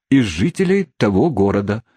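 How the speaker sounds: ordinary delivery, nothing marked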